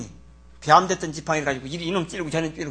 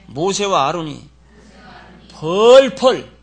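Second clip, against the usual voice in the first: slow, drawn-out loud cry on 펄펄; fuming shout; 9 dB louder, much slower than usual. Shown as a demonstration. Preacher acting out Moses' fury, loud. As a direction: stretch 펄펄 out loud and furious. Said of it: "펄펄 길길이 뛰면서".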